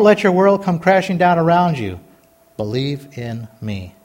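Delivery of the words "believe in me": very soft, low and slow; quiet, deliberate, low-pitched refrain; hushed refrain. Real, not acted. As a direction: drop right down and space the words out.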